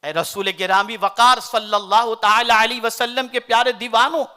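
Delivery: ordinary delivery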